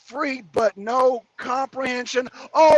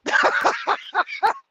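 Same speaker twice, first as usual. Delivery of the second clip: breathy giggle; 8 semitones higher than usual. Real, not acted. Mocking laugh.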